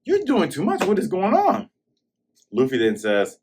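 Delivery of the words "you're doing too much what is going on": high exasperated mock voice; hammed-up disbelief; voicing Kuro. Kuro questioning why Luffy meddles, high mock exasperation. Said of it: "'Cause he's like".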